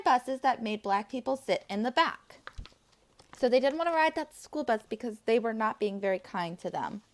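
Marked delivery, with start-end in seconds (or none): none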